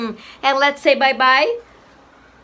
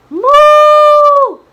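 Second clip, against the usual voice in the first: put-on high, loud girlish moo; long high-pitched cow moo; 11 semitones higher, 9 dB louder than usual. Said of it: "the cow says moo".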